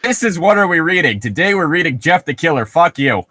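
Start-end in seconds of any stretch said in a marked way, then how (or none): none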